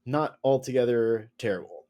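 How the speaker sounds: normal talking voice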